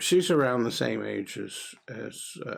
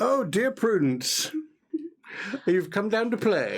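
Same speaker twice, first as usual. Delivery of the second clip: high, slow doting greeting; fond father; 9 semitones higher, slower than usual. Acted. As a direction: coo over your little girl. Father greets his little daughter.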